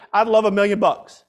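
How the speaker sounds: ordinary delivery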